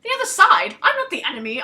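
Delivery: loud, high indignant protest. Acted. Soldier to villagers, loud high protest. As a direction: push back hard, you're offended. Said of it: "I protested".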